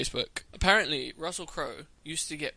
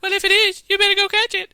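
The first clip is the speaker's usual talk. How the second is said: shrieking high, loud falsetto; 14 dB louder than usual. Acted. Prank caller delivering the punchline, loud squealing star impression. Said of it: "I'm doing [name]".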